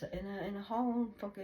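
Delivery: usual speaking voice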